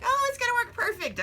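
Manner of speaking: high-pitched, breezy mock confidence; mock optimism. Imagined overconfident self, quoted.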